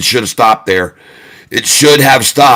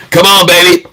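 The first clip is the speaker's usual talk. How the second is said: excited countdown; 8 semitones higher than usual. Real, not acted. Streamer hyping viewers to smash like, excited shouted countdown. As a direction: pump up the chat as you count down.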